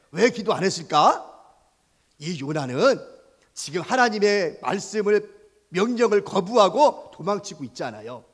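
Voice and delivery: usual speaking voice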